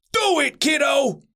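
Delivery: high sideline call; hearty coach shout at modest volume; coach's yell. A narrator's reading. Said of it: "yelled Coach McDye".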